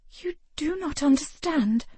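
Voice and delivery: a hint of a headstrong lady; defiant young woman. A narrator's reading. Ravenna frustrated.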